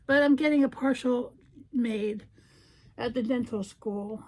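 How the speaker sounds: ordinary talk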